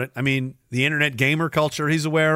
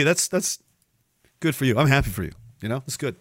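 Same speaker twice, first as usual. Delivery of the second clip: usual speaking voice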